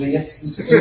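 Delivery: put-on low-pitched, accented deadpan; unbothered hotel clerk. Unbothered hotel clerk answers complaint.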